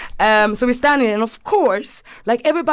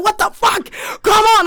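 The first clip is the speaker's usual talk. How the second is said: very high, loud shout to hurry up; retold angry holler at a straggler; exasperated yell; 12 semitones higher, 3 dB louder than usual. Friend running ahead to straggler, exasperated yell.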